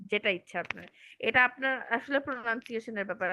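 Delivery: normal talking voice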